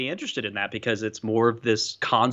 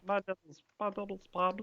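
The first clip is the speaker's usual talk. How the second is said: quiet, higher put-on mimicry; Bobby; 5 semitones higher, 9 dB quieter than usual. Co-host guessing at the streamer's impression, quiet high Bobby voice. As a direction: softly imitate a silly little voice. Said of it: "Your Bobby voice?".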